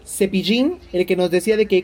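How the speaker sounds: ordinary talk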